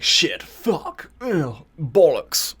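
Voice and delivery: mock posh swearing; posh and foul-mouthed. Co-host mimicking the book's hero, posh and foul-mouthed. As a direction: curse like an exasperated toff, drawing out the last words. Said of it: "'cause he's posh, but, like, really foul-mouthed".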